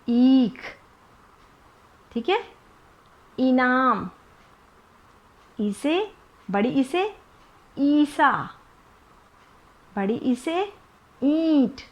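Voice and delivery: long ई drawn out in ईख, इनाम, ईसा, ईंट; teacher modelling a long ई; long ee demo. Shown as a demonstration. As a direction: stretch the ई long in ईख. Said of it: "ई को लंबा खींच".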